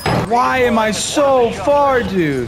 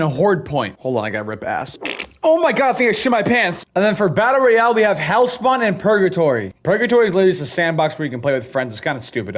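usual speaking voice

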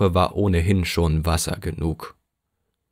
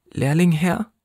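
put-on high, frightened boy's cry; small orphan fearing his fate, trembling; 10 semitones higher than usual. A narrator's reading. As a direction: cry it out scared, much higher. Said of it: "rief der Junge zitternd".

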